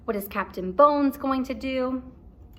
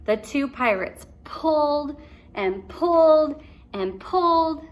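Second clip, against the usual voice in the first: higher, slower, building on each pulled; rising strain on each pulled; 5 semitones higher than usual. A narrator's reading. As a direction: stretch every pulled with effort. Narrating effortful pulling.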